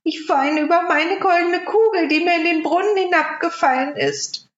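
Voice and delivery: a princess scolding the frog; young princess. Acted. Princess voiced by narrator. (hohe Prinzessinnenstimme)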